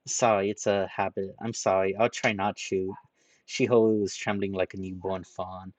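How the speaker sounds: ordinary delivery, nothing marked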